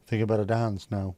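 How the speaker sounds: ordinary talk, nothing marked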